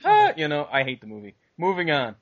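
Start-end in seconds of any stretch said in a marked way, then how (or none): 0.0-0.3 squeaky high cartoon clown voice, cackling; silly clown. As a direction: ham it up like a goofy clown honking. Mocking Beetlejuice's goofy gags.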